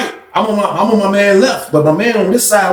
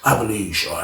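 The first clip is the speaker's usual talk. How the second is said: softer concession; 8 semitones lower, 9 dB quieter than usual. Retold or imitated. Frank backing down.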